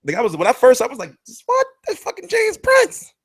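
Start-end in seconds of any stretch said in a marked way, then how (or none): 1.5-3.1 high, slow, cracking cry of awe; can't believe he's standing right there; starstruck cry. Retold or imitated. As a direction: nearly shriek it, starstruck. Starstruck on seeing James Prince.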